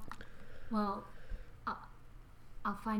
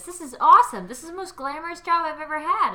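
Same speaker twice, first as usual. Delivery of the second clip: over-the-top gushing; 5 semitones higher, 10 dB louder than usual. Acted. Loud over-the-top job gushing.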